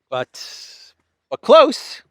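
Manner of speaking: high, loud teasing hint. Real, not acted. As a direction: tease the clue upward.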